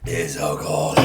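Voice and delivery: wincing at icy beer; sensitive teeth wincing. Real, not acted. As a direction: grimace through the cold. After chugging cold beer.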